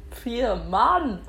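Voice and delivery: frustrated exclamation. Real, not acted. Frustrated at misreading the clock.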